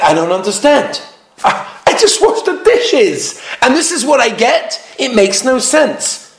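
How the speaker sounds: baffled husband, pitch climbing high; wounded, uncomprehending husband act; acted bewilderment. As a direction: protest in mock disbelief, drawing it out. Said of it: "He doesn't understand".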